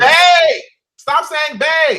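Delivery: loud, high exasperated shout; exasperated yell. Real, not acted. Co-host loudly protesting the Bay nickname, exasperated shout. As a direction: yell out the complaint, drawn slow.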